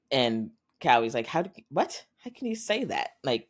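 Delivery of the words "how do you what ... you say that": shocked disbelief. Acted. Son shocked by his father's words.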